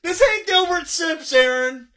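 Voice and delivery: taunting. Real, not acted. Host taunting the drinker.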